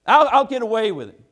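high, loud, cocky inner voice; careless sinner. Retold or imitated. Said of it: "Now you think".

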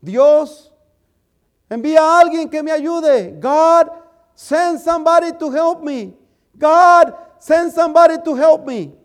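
loud, high desperate cries to God; screaming for help. Retold or imitated. Drunk brother around the corner screaming to God for help. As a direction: yell it out, begging God to send help. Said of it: "somebody was screaming very loud".

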